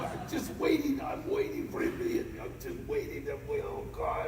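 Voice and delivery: high, thin, frightened whimper; high-pitched acted panic; afraid. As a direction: cower in dread of the coming blow. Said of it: "I was so afraid".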